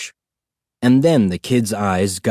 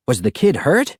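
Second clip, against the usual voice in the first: ordinary delivery, nothing marked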